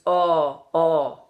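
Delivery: "oh" as a steady "aw", no glide; Irish pure O. Shown as a demonstration. Coach showing the correct vowel, "oh" without glide. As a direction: hold "oh" still and open. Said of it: "so this is".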